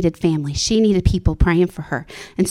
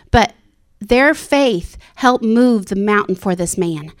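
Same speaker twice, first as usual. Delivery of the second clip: ordinary talk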